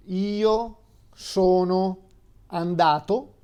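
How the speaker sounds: "io sono andato" slow and careful, each word clear; slow Italian demo. Shown as a demonstration. Teacher slowly models the past tense for a student. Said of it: "the verb to go before".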